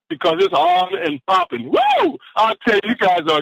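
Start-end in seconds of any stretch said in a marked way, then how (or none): 1.7-2.2 high whooping cheer; real excited whoop; excited woo. Real, not acted. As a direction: whoop with joy. Host fired up after sermon.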